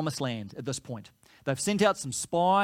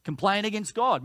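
normal talking voice

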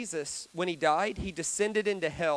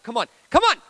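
loud, raised-pitch urging; goading shout; 5 semitones higher, 10 dB louder, faster than usual. Acted. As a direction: provoke him into fighting. Acted tormentors goad Jesus.

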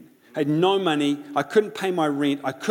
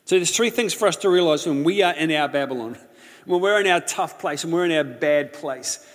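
ordinary delivery

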